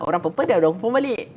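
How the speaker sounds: ordinary delivery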